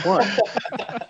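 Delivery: a high "What?" breaking into a laugh. Retold or imitated. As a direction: laugh through the word in mock surprise. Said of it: "Or I'm like".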